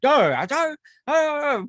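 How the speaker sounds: mock incoherent yelling. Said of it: "fucking yelling".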